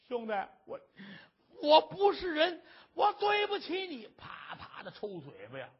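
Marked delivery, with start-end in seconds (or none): 0.1-4.1 悔恨地哭喊，声音高而响; 哭着嚷出来，恨自己; 痛哭自责. Acted. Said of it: "放声痛哭". (高声哭喊着认错)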